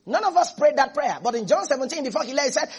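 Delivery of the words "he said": ordinary talk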